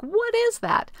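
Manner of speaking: high-pitched mock bafflement; retold puzzled reaction, pitched high; baffled surprise. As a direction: act out a puzzled double take, voice jumping up. Reacting to unfamiliar money, retold.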